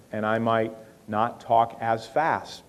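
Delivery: slowed right down, unhurried; slow talk. Shown as a demonstration. Fundraiser showing how to speak to a detail-minded donor, slowly. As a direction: talk at the relaxed speed of an analytical listener. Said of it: "I might talk to him like this".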